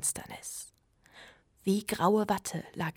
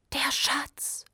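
awed whisper, higher; 8 semitones higher than usual. A narrator's reading. Trude whispering over the hidden hatch. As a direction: breathe the words in wonder. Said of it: "flüsterte Trude".

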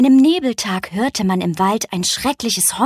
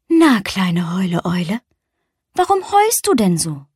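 leicht gespielte, liebevolle Mutterstimme; zärtliche Mama. Acted. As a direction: frag sanft und tröstend wie eine Mutter. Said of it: "umarmt ihr Kind und fragte". (Mama Eule fragt ihr Kind liebevoll und tröstend)

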